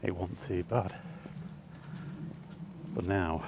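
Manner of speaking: usual speaking voice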